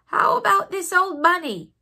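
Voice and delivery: high, loud nursemaid question; Nana asking. Acted. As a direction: call it out brightly as the old nurse. Nana asks the doctor.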